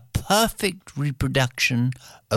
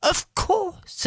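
much higher, sing-song of course; high chorus of locals. Acted. Whole crowd answering the princes, high sing-song. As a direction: everyone chiming in at once, high. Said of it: "everybody says".

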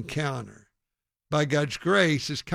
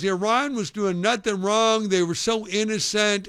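mildly raised mock protest; higher, insistent retold objection; retold yelling; 6 semitones higher, 3 dB louder than usual. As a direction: imitate an indignant heckler, pitch lifted. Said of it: "he just kept yelling at me".

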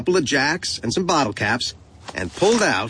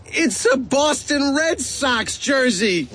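high, drawn-out indignant whine; snapped protest; 12 semitones higher, slower than usual. A narrator's reading. Boy appalled by his present, high indignant snap. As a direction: snap it slowly, pitched way up. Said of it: "I snapped".

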